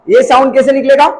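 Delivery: ordinary talk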